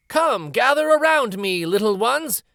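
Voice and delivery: cheerful storyteller's call to gather round. Acted. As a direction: call the children over from across the street. Calling children to the show.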